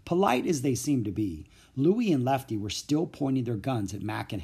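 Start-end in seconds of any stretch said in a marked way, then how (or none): none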